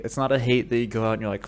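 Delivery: ordinary talk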